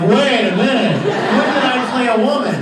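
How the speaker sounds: amused, laughing protest; laughing disbelief. Retold or imitated. Retelling a fan's question, laughing. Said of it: "I'm like".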